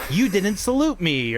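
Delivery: high, drawn-out mock indignation; entitled officer's wife. Officer's wife scolding the gate guard, mock entitled. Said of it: "like, super entitled".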